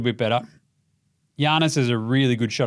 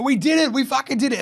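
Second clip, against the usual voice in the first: higher, lightly voiced real celebration; a quick, honest burst of winning; genuine joy; 11 semitones higher than usual. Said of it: "And she's like".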